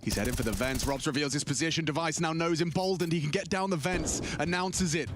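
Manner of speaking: rising play-by-play call; esports caster. Acted. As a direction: build the tension of the clutch. Live play-by-play of the clutch.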